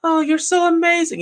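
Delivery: high breathy falsetto swoon; mock damsel falling head over heels; swooning girl. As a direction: gush like a lovestruck teen girl.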